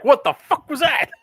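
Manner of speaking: high, slow, laughing critter voice; startled possum voice. Retold or imitated. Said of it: "the possum probably is like".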